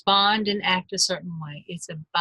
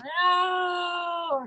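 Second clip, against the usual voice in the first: long high whiny "no"; drawn-out fake tantrum wail; mock whining wail; 10 semitones higher than usual. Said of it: "I wanna whine with you".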